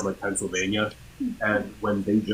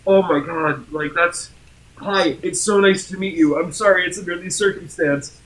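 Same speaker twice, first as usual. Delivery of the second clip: higher, louder imitation of a flustered fan; starstruck greeting; 8 semitones higher, 4 dB louder than usual. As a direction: gush apologetically, as if meeting an idol. Retold starstruck fan meeting speaker.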